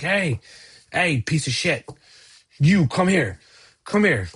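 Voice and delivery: thug shouting. Acted. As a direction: bark at the player to come over.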